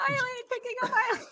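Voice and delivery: high, rushed mock call, held back in volume; airport greeter. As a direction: play an overeager guy with a banner. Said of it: "You'll be that guy at the airport".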